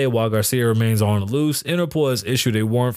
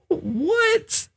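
"What?" in a very high, cracking squeal; shocked squeak. Real, not acted. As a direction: disbelief squeezed up high. Shocked at story.

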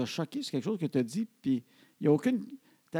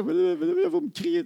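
high, loud spluttered accusation; mock a flustered, outraged teenager; indignant teen protest; 12 semitones higher, 5 dB louder, slower than usual. Father replays daughter's accusation of yelling, spluttering mock outrage. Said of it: "je trouve ça drôle comme expression".

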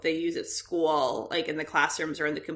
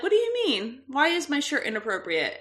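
mock innocent surprise; 6 semitones higher than usual. Mom feigns innocence to son.

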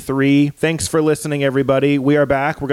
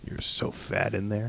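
much softer, slow teasing murmur; soft slow taunt spoken to a belly; mock hushed taunt; 3 semitones lower, 11 dB quieter than usual. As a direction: coo an insult into the belly.

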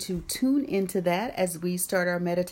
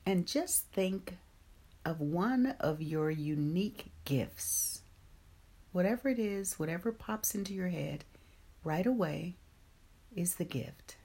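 guided meditation; 5 dB quieter than usual. Acted. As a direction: ease them gently into calm.

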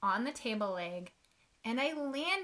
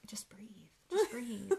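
whispered reassurance. Retold or imitated. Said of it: "people in line ... were like".

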